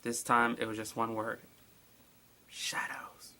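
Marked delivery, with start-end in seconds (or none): 2.5-3.3 soft eerie whisper; creepy whispered note; spooky whisper. Acted. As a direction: breathe the word like a ghost. Reading the note's one word.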